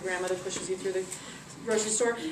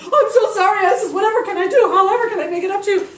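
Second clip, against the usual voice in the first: high, loud, gushing apology; frantic apology; 9 semitones higher, 12 dB louder, faster than usual. Acted. Rich lady pleading with Isis, frantic apology. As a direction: beg forgiveness in a hammed-up panic.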